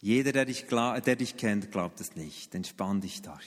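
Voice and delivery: calm dad down, lightly voiced; daughter reassuring. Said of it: "Dann lacht sie und sagt".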